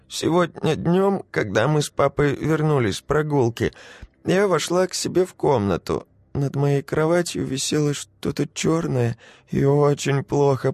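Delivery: put-on high tearful little girl; a frightened child telling. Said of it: "ответила девочка и заплакала".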